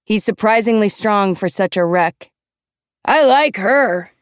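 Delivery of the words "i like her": high, drawn-out boozy shout; hollering drunk. A narrator's reading. Drunken mentor approving the volunteer, hollering. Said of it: "he hollers".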